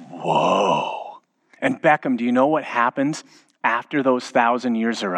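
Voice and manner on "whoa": put-on awed child. Son hearing the thousand-year sentence, drawn-out awe. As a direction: imitate a boy's hushed amazement.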